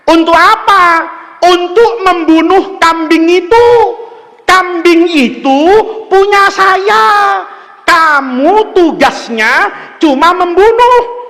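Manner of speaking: mock-solemn orders, slow and high; loud slow instruction. Shown as a demonstration. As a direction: spell out the order slowly to a dog. Acts out instructing the dog.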